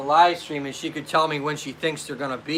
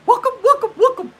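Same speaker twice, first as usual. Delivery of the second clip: loud, laughing falsetto greeting; high-pitched imitation; 8 dB louder, faster than usual. Greeting viewers as she says it, loud falsetto mimicry. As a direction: jump up into her very high voice. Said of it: "as she says".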